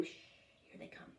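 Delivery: hushed unvoiced whisper. A narrator's reading. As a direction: hush them as the girls appear. Hushing in story.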